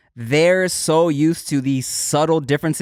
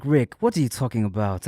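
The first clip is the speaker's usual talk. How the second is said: put-on lightly done Wakanda accent, lower; 7 semitones lower than usual. Retelling his skit line to Rick, in a light Wakanda accent.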